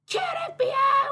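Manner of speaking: squeaky demanding cat; high-pitched cat demanding entry. Retold or imitated. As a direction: play the cat banging on the door. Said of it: "I hear".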